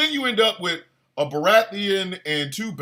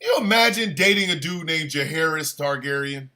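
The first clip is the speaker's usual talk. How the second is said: ordinary talk, nothing marked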